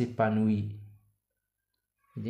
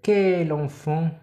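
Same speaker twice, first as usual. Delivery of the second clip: slow "quel enfant" with "quel" lifted and louder; exclamative reading; 5 semitones higher, 5 dB louder, slower than usual. Shown as a demonstration. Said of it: "cet, cet". (تعجب بطيء مع رفع "quel")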